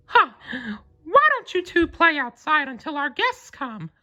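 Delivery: high falsetto cartoon mouse, friendly; Mickey Mouse voice. A narrator's reading. Mickey shooing the pets outside, high and friendly. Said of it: "Mickey suggested".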